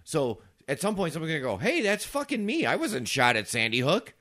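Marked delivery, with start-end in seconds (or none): 1.6-4.1 high, mock-outraged protest; indignant outburst. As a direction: act out someone loudly objecting. Acted outburst of supposed victim.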